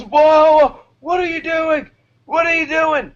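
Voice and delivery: high, squealing mock panic; freaked-out flight attendant. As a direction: imitate the attendant losing it. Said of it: "He lost his mind".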